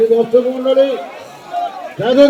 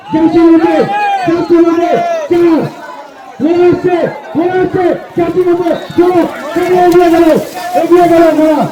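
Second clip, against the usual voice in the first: race caller; 3 semitones higher than usual. Real, not acted. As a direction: call the horse race live, building excitement. Live horse race commentary.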